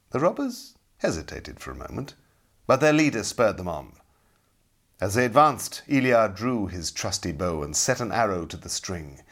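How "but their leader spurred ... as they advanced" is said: ordinary delivery, nothing marked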